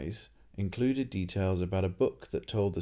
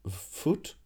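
higher, odd puzzled echo of the word; puzzled foreigner; 8 semitones higher than usual. Acted. Tourist echoing the innkeeper's word, puzzled and higher. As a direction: try out a strange word.

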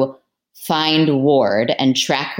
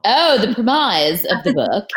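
put-on British Jersey accent; 5 semitones higher than usual. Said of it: "in her British Jersey accent".

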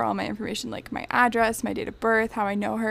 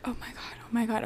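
breathless whispered panic; whispered oh my God while reading the text; panicked whisper; 9 dB quieter than usual. Retold or imitated. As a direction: hiss it to yourself in shock. Panicked under her breath.

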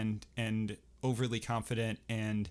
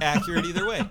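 laughing; 7 semitones higher, 8 dB louder, faster than usual. Real, not acted. Laughing at the erotic mix-up.

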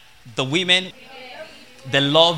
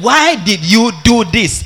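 slow, loud, higher-pitched demand; questioning husband; 6 semitones higher, 8 dB louder, slower than usual. Acted. Husband confronting his wife before she starts crying, loud questioning. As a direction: play an exasperated man demanding an explanation. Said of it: "We are asking".

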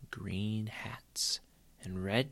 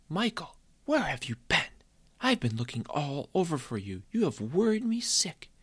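high, louder scolding; worried scolding mother; 8 semitones higher, 3 dB louder than usual. Acted. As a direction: let the worry burst out as a scold. Mother yells at missing son.

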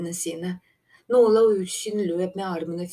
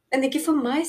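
higher, hopeful little objection; 7 semitones higher, 3 dB louder than usual. A narrator's reading. Myrtel thinks the gap may fit, hopeful. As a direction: pipe up with hope, pitched high. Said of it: "sa Myrtel håpefullt".

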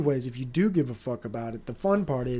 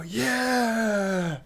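a light retold cheer, higher; mock yell; 7 semitones higher than usual. Host retelling his cheer at the craps table, a mild mock yell. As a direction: whoop it like you just won. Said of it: "And I'm shouting".

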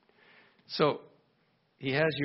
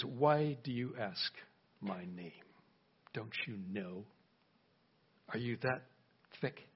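much softer, lower angel voice, mildly scolding; stern angel; 4 semitones lower, 8 dB quieter than usual. Acted. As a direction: question him low and quiet, a touch of disdain. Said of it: "the angel of God says".

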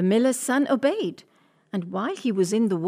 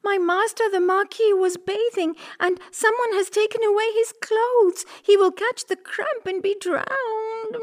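distressed cat; 11 semitones higher than usual. Acted. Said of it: "said Puss".

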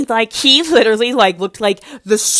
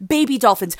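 normal talking voice

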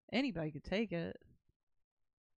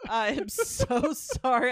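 laughing apology; 7 semitones higher, 11 dB louder than usual. Real, not acted.

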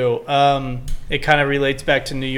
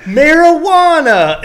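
high, loud, stretched exclamation; mock outraged shout; 12 semitones higher, 7 dB louder, slower than usual. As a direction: shout it like a scandalized mom's text. Acts out mom's exclamatory text.

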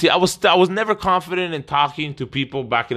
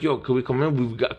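timid ask; 3 semitones lower, 5 dB quieter than usual. Retold or imitated. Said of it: "And I'm like".

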